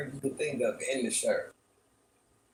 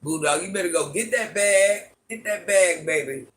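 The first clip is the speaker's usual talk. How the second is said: hyped-up urging; 5 semitones higher, 5 dB louder than usual. Real, not acted. Hyping a viewer about pay.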